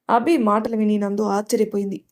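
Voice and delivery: usual speaking voice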